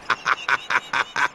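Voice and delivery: high, staccato scornful laugh; mocking laugh. Stationmaster scoffing at the dispatcher's fame, mocking laugh. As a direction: laugh long at your own jibe.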